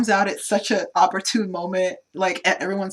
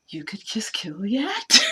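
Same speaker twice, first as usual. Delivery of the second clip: whispered suggestion; 2 semitones higher than usual. Retold or imitated. Character pleading with gunman, retold.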